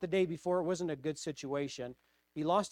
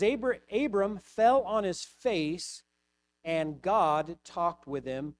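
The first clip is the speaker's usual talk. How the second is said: scripture reading; 3 semitones higher, 5 dB louder, slower than usual. Acted. Reading scripture verse aloud.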